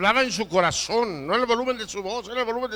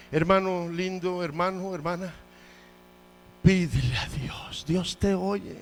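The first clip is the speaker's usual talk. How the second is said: usual speaking voice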